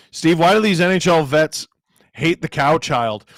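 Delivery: ordinary delivery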